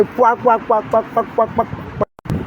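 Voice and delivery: mocking sputter noises trailing away; mock splutter sounds. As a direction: spit out the pouacs, dying off to a breath. Mocking mouth that runs like diarrhea.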